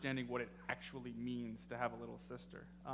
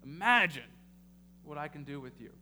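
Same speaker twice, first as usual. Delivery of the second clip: rising preacher's build to the congregation; preaching; 3 semitones higher than usual. Acted. Pastor urging his congregation, building preaching. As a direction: drive the point home like a sermon.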